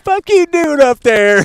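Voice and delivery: high, loud mock of the trailer line; high mocking parody; mocking imitation. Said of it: "quoting trailer line".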